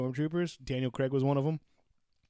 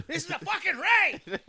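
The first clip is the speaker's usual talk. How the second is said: high hammed-up raid cry; mock battle cry; 14 semitones higher than usual. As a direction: yell it like charging in. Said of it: "go full regalia".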